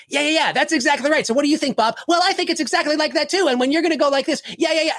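hammed-up high, nonstop eager agreement; hyper brothers impression. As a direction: play two identical hyper brothers interrupting each other. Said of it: "it sounded like between the two of them".

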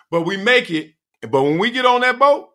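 ordinary talk, nothing marked

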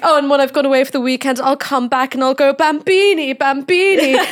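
loud, high, hammed-up fond papa; doting posh father. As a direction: mimic a posh dad calling to his children. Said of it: "stories about his really posh children".

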